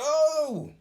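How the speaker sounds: high, very loud cry; sudden hammed-up holler; retold shock. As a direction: yelp in horrified surprise. Shocked at afterbirth.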